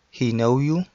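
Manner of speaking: ordinary delivery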